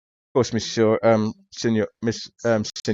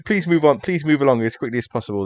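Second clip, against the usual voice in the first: mock-harried policeman moving someone on; flustered officious detective; 6 semitones higher, 4 dB louder, faster than usual. Detective urging a stubborn tourist away, flustered. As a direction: repeat the plea over the interruptions.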